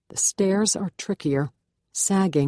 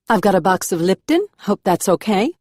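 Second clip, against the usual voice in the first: higher, louder South American lilt with a Southern drawl; South American Southern accent; 4 semitones higher, 6 dB louder than usual. A narrator's reading. Curious housemate offers the new girl help, South American Southern accent, higher and louder. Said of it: "a South American cadence slowed with a Southern drawl".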